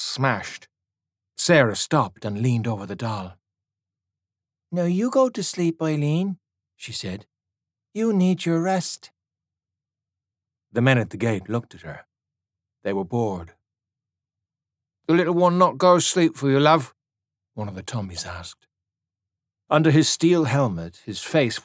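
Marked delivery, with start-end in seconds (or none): 4.7-6.4 put-on high, gentle little girl's voice; girl soothing doll. A narrator's reading. Girl to her doll, high and soothing. 7.9-9.1 put-on high, gentle little girl's voice; girl soothing doll. A narrator's reading. Girl to her doll, high and soothing. 15.1-16.9 higher, louder friendly English soldier; teasing young soldier. A narrator's reading. Young Tommy to the girl, friendly teasing.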